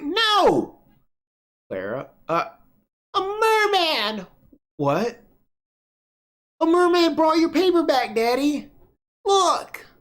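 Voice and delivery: put-on very high excited child voice; a kid bursting to show her dad a merman; excited little girl. Clara tells her daddy a merman brought the paper back, very high excited child.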